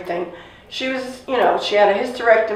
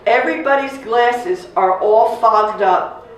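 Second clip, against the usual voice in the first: usual speaking voice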